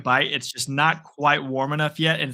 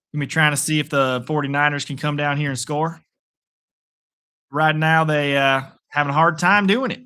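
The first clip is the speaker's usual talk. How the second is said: easygoing, playful Southern twang; Southern accent; 4 dB louder than usual. Pretend. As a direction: call the game like a folksy Southerner. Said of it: "Do a Southern accent, I'll tell you how it is".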